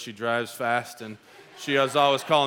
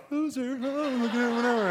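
high, laughing mock taunt; 9 semitones higher than usual. Wife mouthing taunts in the mirror, laughing and high. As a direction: tease him through the mirror. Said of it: "she's in the mirror going".

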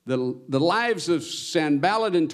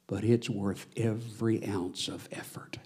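hushed close; 5 semitones lower, 8 dB quieter than usual. Acted.

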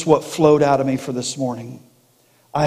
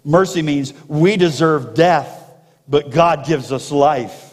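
ordinary talk, nothing marked

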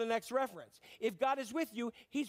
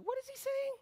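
much higher, bewildered question; mock puzzled girl. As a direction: squeak it like a confused teenage girl. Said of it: "Where she's like".

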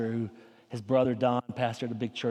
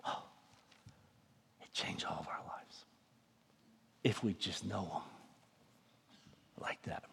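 much softer, unvoiced whisper; hushed whisper; 6 semitones lower, 13 dB quieter than usual. Real, not acted. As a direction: drop to a breath after the shouting. Hushed close after the climax.

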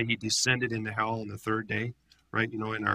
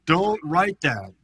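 higher, louder, slow insistent protest; religious leaders protesting to Pilate, slow and loud; 8 semitones higher, 6 dB louder, slower than usual. Retold or imitated. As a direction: beg Pilate, slowly, not to write it. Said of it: "They're like".

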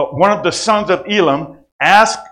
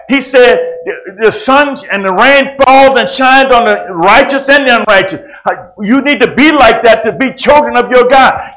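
preaching; 8 semitones higher, 7 dB louder than usual. Acted. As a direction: preach it with rising fire. Preaching at full force.

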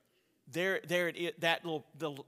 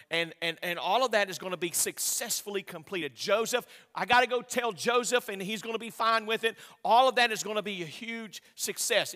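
louder, impassioned sermon build; preaching with force; 5 semitones higher, 10 dB louder than usual. Real, not acted. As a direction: drive it home to the congregation.

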